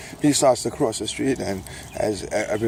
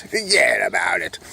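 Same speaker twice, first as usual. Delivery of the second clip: Brooklyn catchphrase; 5 dB louder than usual. Acted. Recurring catchphrase voice.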